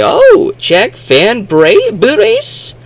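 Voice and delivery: very high, slow mock query; imitated puzzled translators. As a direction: try the name out loud, rising into a question. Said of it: "And they say".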